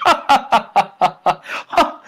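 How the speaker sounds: a cocky, put-on cackle; mocking laugh. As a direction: laugh right in his face. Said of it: "and he's laughing".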